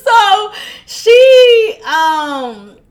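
loud, high laughing burst; a loud, high laughing burst at "So she"; burst of laughter. Real, not acted. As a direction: crack up mid-sentence. Laughing at herself.

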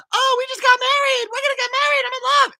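high-pitched squealing bride-to-be; gushing Anna. Acted. Younger sister announces her engagement at the coronation, high giddy squeal. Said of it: "she's over there, and she's like".

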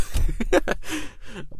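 high burst of laughter. Real, not acted. Laughs about running to car.

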